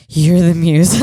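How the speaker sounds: loud, over-insistent breathy retort; breathy guy. Retold or imitated. Fan arguing back in a muse contest, mock breathy guy. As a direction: throw the compliment back hard, imitating a breathy guy. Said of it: "I was the breathy guy".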